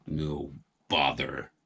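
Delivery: low, soft and slow grumble; sulky farmer under his breath; grumpy mumble. A narrator's reading. Farmer shuffling off, sulky mumble.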